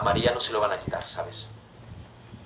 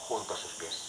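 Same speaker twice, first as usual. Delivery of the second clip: "junto a sus pies" repeated much softer and lower; soft echo; 5 semitones lower, 12 dB quieter than usual. Shown as a demonstration. Preacher echoes the Gospel words softly. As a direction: murmur "junto a sus pies" back quietly.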